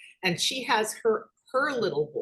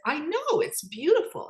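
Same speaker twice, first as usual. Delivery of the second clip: eager agreement; 9 semitones higher, 5 dB louder than usual. Retold or imitated. Agreeing with grandson about sky.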